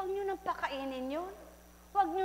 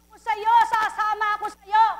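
high, loud, eager vow to follow; eager promise; 8 semitones higher, 13 dB louder than usual. A narrator's reading. Mute man vowing to follow the voice, high and loud. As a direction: burst out, eager to go along. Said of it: "Sabi ng pipi".

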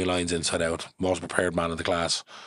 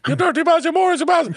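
much higher and much louder, screaming abuse; furious shout; 9 dB louder than usual. Retold or imitated. Feuding man screaming abuse at Morris. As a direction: scream it at your enemy. Feuding families killing each other.

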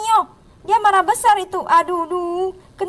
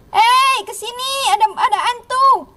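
high-pitched call, drawn-out ending; play-acted alarm, pitched up; mock alarmed shout; 7 semitones higher than usual. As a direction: call out a pretend ghost warning. Prank call warning of ghost.

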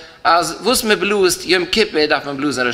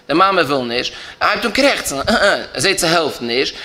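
normal talking voice

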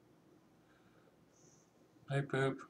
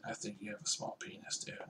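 mock whisper; 7 semitones higher, 18 dB quieter, faster than usual. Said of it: "You have to announce it".

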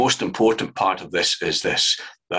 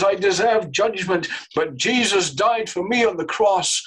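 high, rising preacher's fervour, pressing every phrase; preaching at full force; 9 semitones higher than usual. Acted. Preacher to congregation, fervent high preaching at full force. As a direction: pour it out from the pulpit, higher and higher. Preacher at climax on Christ's sacrifice.

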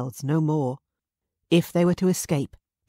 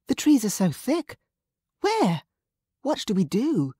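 frantic; 7 semitones higher than usual. Acted. Marie frightened and excited.

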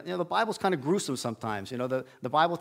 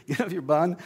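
laughing correction; 2 semitones higher than usual. Retold or imitated.

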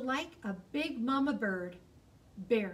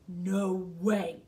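bear refusal; 4 semitones lower, 5 dB louder than usual. Acted. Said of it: "said Bear".

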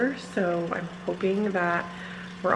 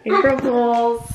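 playful reveal; 5 semitones higher, 8 dB louder, slower than usual. Real, not acted. Revealing prank to kids.

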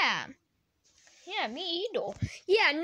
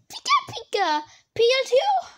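high, loud little creature voice; Pikachu; 7 semitones higher, 5 dB louder than usual. Pretend. Creature answering who are you, high cartoon voice. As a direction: chirp it up high like a cartoon critter.

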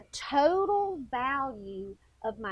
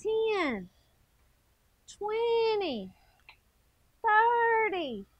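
higher sing-song counting of reps, drawn out; imitating workout count; 9 semitones higher, much slower than usual. Teacher shows kids how she counted reps, in a high, drawn-out chant. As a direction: replay how she counted while exercising. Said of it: "I was going".

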